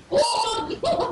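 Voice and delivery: high startled yelp of anger; husband's outburst. Retold or imitated. Husband erupting at his wife over the idols, mock yell. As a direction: burst out like a furious husband.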